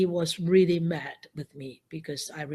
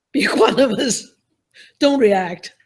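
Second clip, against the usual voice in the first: self-talk laughing; 2 semitones higher, 8 dB louder than usual. Retold or imitated. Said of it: "I said to myself".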